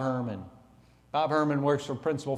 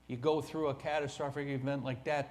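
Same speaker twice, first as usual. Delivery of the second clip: ordinary talk, nothing marked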